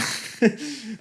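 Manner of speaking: high laugh at himself; rueful laugh. Real, not acted. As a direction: laugh it off, exasperated. Laugh after news of moving.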